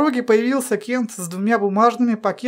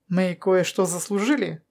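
plain reading voice

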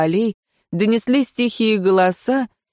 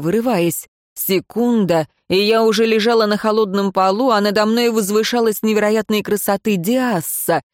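ordinary delivery

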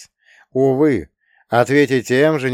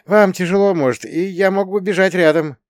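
high-pitched polite suggestion; high narrator-hero voice; earnest offer; 9 semitones higher than usual. A narrator's reading. Young hero offers to run.

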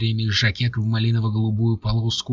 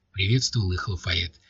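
ordinary delivery, nothing marked

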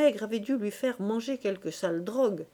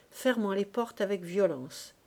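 plain reading voice

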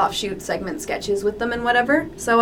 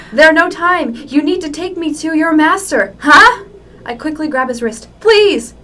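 higher, louder desperate pleading; hammed-up urgency; 5 semitones higher, 6 dB louder than usual. Jack begs Cry to take him to the master. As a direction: overplay the urgent begging. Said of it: "reading the fic aloud".

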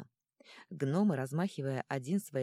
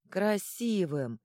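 answered in unison; 4 semitones higher, 5 dB louder, much slower than usual. A narrator's reading. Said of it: "в один голос ответили".

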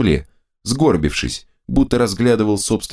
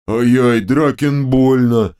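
two bickering giant voices; 5 semitones higher, slower than usual. A narrator's reading. Dim head hurt.

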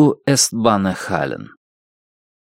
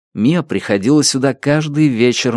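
ordinary delivery

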